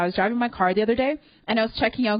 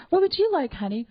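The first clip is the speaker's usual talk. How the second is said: sweet Southern waitress, much higher pitch; Southern accent; 7 semitones higher than usual. Retold or imitated. Waitress asking her date's order, light Southern accent. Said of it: "they all have Southern accents in my head".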